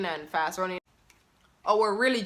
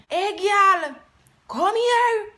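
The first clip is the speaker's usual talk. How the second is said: imitating mom's call, much higher and louder; 11 semitones higher, 7 dB louder, slower than usual. Jamaican mom calling her daughter to come, loud high call. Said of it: "my mom would say".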